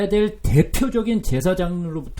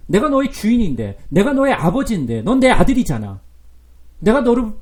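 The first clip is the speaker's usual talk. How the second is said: loud, insistent father's appeal, louder; pleading loud as God; 3 semitones higher, 7 dB louder than usual. Acted. God as father confronting his son, loud and insistent. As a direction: press the son hard, voice raised.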